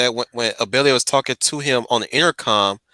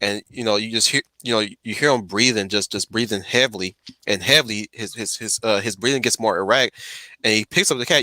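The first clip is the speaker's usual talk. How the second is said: ordinary delivery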